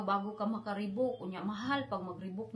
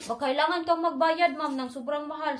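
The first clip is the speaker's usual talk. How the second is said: demanding staff; 5 semitones higher, 6 dB louder than usual. Retold or imitated.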